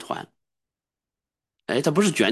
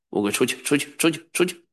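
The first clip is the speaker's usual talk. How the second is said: commanding exorcism; 5 semitones higher than usual. Shown as a demonstration. Demonstrates casting out a demon.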